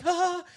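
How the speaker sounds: very high, loud yelp of fright; startled yelp. Retold or imitated. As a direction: jolt awake with a cry. Seeing possum when eyes close.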